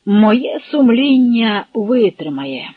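smug, drawn-out assurance; gloating. A narrator's reading. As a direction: savour it with malicious relish. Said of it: "— зловтішно усміхнувся комісар".

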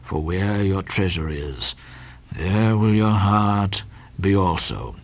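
low, slow scripture drone; deep, ponderous pious murmur; mumbling. Acted. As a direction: intone the verse deeply to yourself. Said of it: "then went on mumbling".

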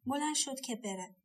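plain reading voice

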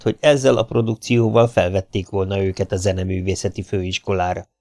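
plain reading voice

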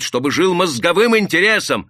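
«мозговым» громко и выше, убеждённо; выдели «мозговым» с напором; повышенный голос. Acted. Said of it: "Рабочий повысил голос". (рабочий громко произносит «мозговым»)

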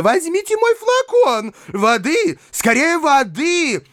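very high-pitched call for help; high, hysterical lady's shrieking; frantic cry. A narrator's reading. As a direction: wail for help in a panic. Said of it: "кричала Анфиса Петровна".

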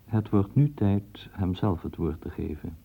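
usual speaking voice

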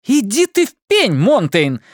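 high furious yell; angry shout. A narrator's reading. Anna shouting at Monteyn in front of the chief, furious yell. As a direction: tell him off at the top of her voice. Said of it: "крикнула она".

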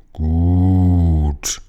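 low, gurgling sea king; deep gurgling voice. Acted. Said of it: "mit einer ganz tiefen, gurgelnden Stimme".

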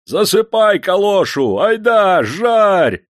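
high, loud, drawn-out bellow; roaring order. A narrator's reading. Foreman up top orders workers to load the furnace, roaring. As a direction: roar it out over the furnace. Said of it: "заревел наверху мастер".

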